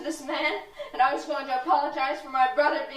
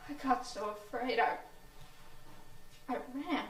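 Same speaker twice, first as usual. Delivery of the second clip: normal talking voice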